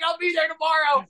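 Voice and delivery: very high, giddy squeal; hammed-up overeager yelp; mock eagerness. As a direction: squeal it like an excited fan. Replays his eager acceptance, exaggerated.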